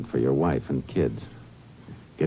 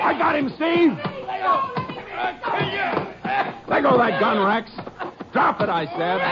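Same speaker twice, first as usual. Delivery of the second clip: commanding shout. Acted. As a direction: bark orders to drop the gun. Ranger seizes the killer.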